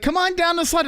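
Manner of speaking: put-on high child's voice; imitating little kid. Toddler urging dad down the slide, high child voice. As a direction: call it up sweetly like a toddler. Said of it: "He's like".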